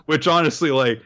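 normal talking voice

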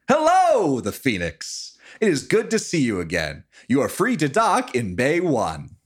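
high, loud radio hail. Acted. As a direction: crackly voice over a ship radio. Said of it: "you hear a voice come out over the radio".

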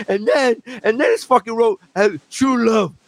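high-pitched, incredulous gossip voice; mocking teacher. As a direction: imitate a teacher ridiculing a pupil over drinks. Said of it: "I'm sure that she was like".

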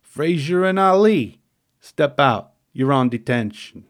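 loud gruff Italian-American New York proctor, heavy stress on the second syllable of detention; hammed-up tough-guy lunch monitor ordering a kid out; gruff Mister Delia. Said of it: "he barked".

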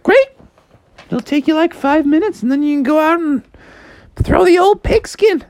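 high-pitched mom voice; high, easygoing mom suggesting a game; Froggy's encouraging mom. Acted.

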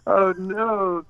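drawn-out, high-pitched groan of dismay; mock dismay. Diner learning the boss bought his favorite place, mock-horrified. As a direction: wail it in comic horror.

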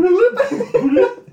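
very high squeaky bloop noise; phone bloop. Retold or imitated. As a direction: imitate a phone hanging up.

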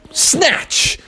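high, explosive single word; sudden grab. Acted. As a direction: pounce on the word like catching a fish. Bear demonstrates sudden grab.

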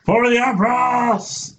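high, slow ringing war cry; battle cry. Acted. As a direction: shout it back like a zealot.

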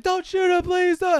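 high, loud mock begging; hammed-up desperate shout; screaming plea. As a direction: imitate kids screaming for mercy. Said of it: "they're all screaming".

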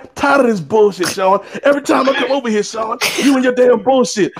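high-pitched indignant rant, not shouted; retold angry outburst. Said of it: "I'm going off".

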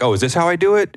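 high, wide-eyed beginner asking how it's done; naive newbie. Retold or imitated. Imitating a gifted newcomer to a coach, naive and high. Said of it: "they're like".

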